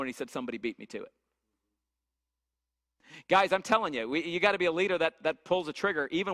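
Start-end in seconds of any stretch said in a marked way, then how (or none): 0.3-1.1 low, much softer, tearful muttered confession; crying on the phone. Retold or imitated. Client calls coach a year later, teary and quiet. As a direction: mimic a client sobbing down the line. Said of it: "he's crying on the phone".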